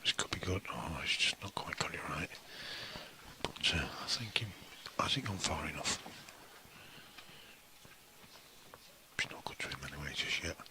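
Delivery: hushed, tense commentary whisper; snooker commentary whisper. Acted. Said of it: "add a bit of drama to it there".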